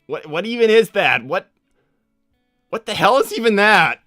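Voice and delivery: high, loud disbelief; baffled outburst. Acted. Sonic to chatter about a strange message, baffled outburst. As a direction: demand an explanation, loud and bewildered.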